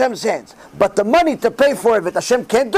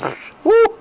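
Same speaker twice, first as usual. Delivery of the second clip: amazed whistle; 11 semitones higher than usual. Real, not acted. Marvelling at nine months.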